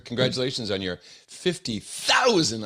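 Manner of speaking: big booming announcer voice; monster truck ad. Acted. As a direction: hype it like a stadium announcer. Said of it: "I have to say that like a monster truck announcer".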